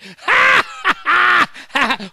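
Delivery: loud, high, jeering laugh; God's mocking laugh. God laughing at the sinner, loud jeering laugh. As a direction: cackle at the sinner's plea. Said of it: "I'm gonna laugh".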